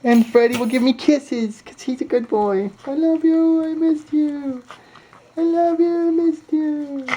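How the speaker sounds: very high, slow cooing voice; squeaky doting pet voice. Real, not acted. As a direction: coo at the dog. Talking to the dog.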